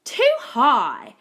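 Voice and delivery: loud, exclaimed complaint; storybook yell. A narrator's reading. Goldilocks trying Daddy Koala's bed, yelling. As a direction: yell it out in dislike. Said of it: "she yelled".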